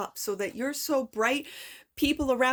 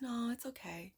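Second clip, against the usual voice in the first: "No, it's okay." said softly and meekly; a submissive voice giving in; soft little voice; 9 dB quieter than usual. Retold or imitated. Reader voicing her ego, soft and meek. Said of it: "that soft little voice that says".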